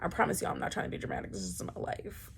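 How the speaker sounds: breathy whisper, fast then sinking low; soft hush to the viewers; hushed aside. Real, not acted. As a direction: whisper it like a confession. Quiet aside after outburst.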